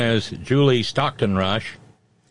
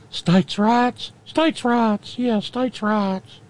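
high, thin, reedy Jefferson voice; pinched high voice, slowing at the end; 12 semitones higher, much slower than usual. Retold or imitated. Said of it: "High, thin, reedy voice".

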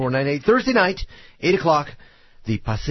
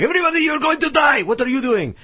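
hostage-taker yelling; 14 semitones higher than usual. Acted. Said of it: "being the dog".

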